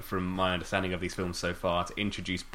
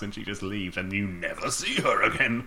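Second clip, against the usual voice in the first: normal talking voice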